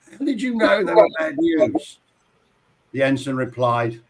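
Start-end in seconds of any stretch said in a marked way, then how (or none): 0.1-2.0 higher-pitched acted question; baffled alien captain. Dazed captain questioning his ensign after the blast, higher-pitched. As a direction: play a captain wanting an explanation. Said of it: "the captain says to the ensign".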